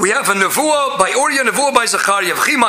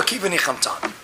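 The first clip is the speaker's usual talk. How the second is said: soft low echo; 8 semitones lower, 7 dB quieter than usual. Shown as a demonstration. Teacher echoing the Talmud's closing words, soft and low. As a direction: lower and softer, like an echo.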